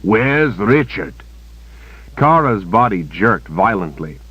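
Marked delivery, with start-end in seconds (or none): 0.0-1.1 slow, pressing, a bit louder; cold interrogation. Acted. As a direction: press her harder. Villain repeats louder.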